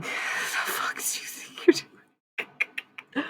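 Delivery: slow, breathy whispered challenge; a slow, menacing whisper at the intruder; hissed whisper. Real, not acted. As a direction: whisper it like a threat. Hissed at the unseen attacker.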